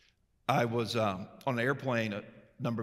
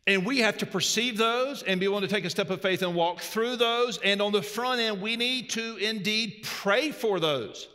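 raised, ringing preacher voice; preaching; 9 semitones higher, 4 dB louder than usual. Acted. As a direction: preach it out to the congregation. Preaching at full force.

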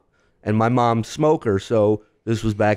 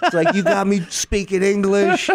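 higher-pitched mock outrage in an Israeli accent; hammed-up indignation, not truly shouted; retold yelling; 7 semitones higher than usual. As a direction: imitate an exasperated Israeli customer complaining. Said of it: "he starts yelling at me in Hebrew".

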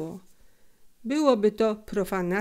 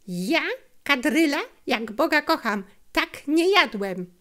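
protesting innocence; 7 semitones higher, 4 dB louder than usual. Acted. Felek swearing innocence.

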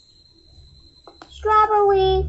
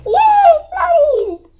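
excited cheer; 11 semitones higher, 5 dB louder, much slower than usual. Acted. Kids cheering for floaties.

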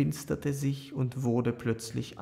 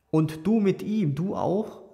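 higher, louder shocked question; dismayed cry; 6 semitones higher, 5 dB louder than usual. A narrator's reading. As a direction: blurt it out, taken aback. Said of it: "rief Aljoscha betroffen". (betroffener Ausruf)

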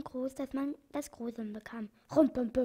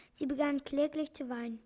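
plain reading voice

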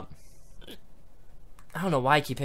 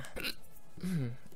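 throat clearing; 12 dB quieter than usual. Real, not acted. Clears throat mid-video.

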